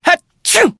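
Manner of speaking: big high sudden sneeze. Acted. Librarian sneezing mid-conversation, loud high sneeze. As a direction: let a loud sneeze burst out.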